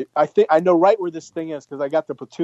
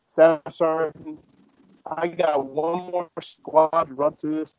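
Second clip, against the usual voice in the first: slow, drawn-out words to a sergeant; deliberate defiance; slower than usual. Retold or imitated. Lieutenant standing up to range sergeant, slow and defiant. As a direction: spell it out slowly, standing your ground.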